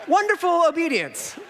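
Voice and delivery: high laughing mock praise; sarcastic laugh. Real, not acted. Father jokes about the half-dressed boys, laughing. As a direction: laugh through a dry joke. Kids came in underwear.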